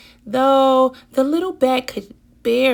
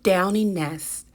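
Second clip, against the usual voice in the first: plain reading voice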